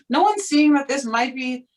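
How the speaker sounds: high-pitched mock disbelief, laugh breaking in; incredulous. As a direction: appeal to the room in disbelief. Retold inner disbelief in the room.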